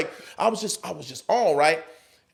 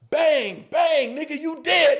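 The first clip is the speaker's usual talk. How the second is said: pretend shooting; 10 semitones higher, slower than usual. Acted shooting in improv exercise.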